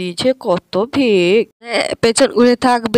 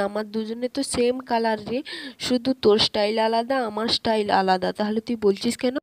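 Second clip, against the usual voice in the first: much softer, secretive talk; hushed sibling aside; 9 dB quieter than usual. Acted. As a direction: keep it down so mom won't hear. Secret talk between sisters. (চাপা গলায় বোনের সাথে কথা)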